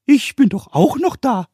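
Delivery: hohe, laute Koboldstimme, neugierig; fragender Kobold. A narrator's reading. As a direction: Kobold fragt neugierig nach. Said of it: "sagte der Pumuckl". (Pumuckl fragt Meister Eder, hoch und laut)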